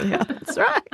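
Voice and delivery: high, playful Southern twang on That's right. Shown as a demonstration. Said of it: "with a little bit of a twang".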